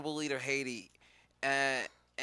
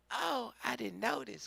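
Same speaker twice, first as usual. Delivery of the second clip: higher, softer put-on voice; mock surprised wife; 8 semitones higher, 7 dB quieter than usual. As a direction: feign innocent surprise, softly. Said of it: "and she said".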